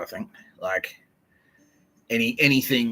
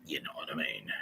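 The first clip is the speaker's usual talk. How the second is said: low, soft slogan delivery; hushed catchphrase; 5 semitones lower, 11 dB quieter than usual. Acted. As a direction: drop it down low and quiet. Repeats the catchphrase low and quiet.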